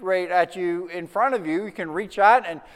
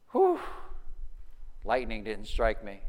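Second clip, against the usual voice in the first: relieved visitor; 8 semitones lower, 9 dB quieter than usual. Retold or imitated. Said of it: "they're like".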